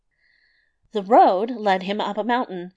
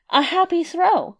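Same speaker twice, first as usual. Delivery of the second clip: mocking; 9 semitones higher, 3 dB louder than usual. A narrator's reading. Tailor's mocking praise.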